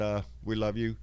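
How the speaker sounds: ordinary talk, nothing marked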